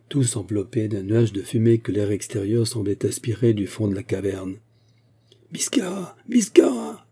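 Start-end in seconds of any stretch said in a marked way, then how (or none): 5.5-7.1 high-pitched frantic shout of a name; first cry thin, the second louder. A narrator's reading. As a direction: scream for the man who betrayed you. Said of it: "criaient les fuyards".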